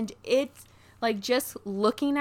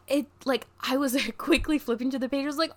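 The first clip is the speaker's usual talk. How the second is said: ordinary talk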